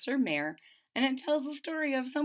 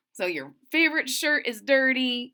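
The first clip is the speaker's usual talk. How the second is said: plain reading voice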